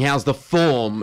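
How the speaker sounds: How's the form said slowly, form with an Irish vowel. Retold or imitated. To the co-host, revealing the Irishman's slow How's the form. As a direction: stretch the word form as the Irishman meant it. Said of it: "he's saying".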